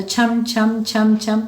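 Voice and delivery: slow, sing-song chham chham like ankle bells; anklet jingle imitation. Shown as a demonstration. Teacher showing pupils the anklet's sound, slow playful jingle. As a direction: jingle it out slowly like payal bells. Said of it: "पायल की आवाज़".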